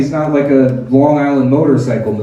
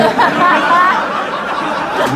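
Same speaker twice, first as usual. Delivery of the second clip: high cackle; 14 semitones higher than usual. Real, not acted. Laughing at own joke.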